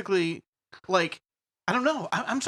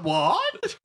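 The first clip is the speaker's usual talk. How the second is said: startled high what; 12 semitones higher than usual. Real, not acted. Startled at face.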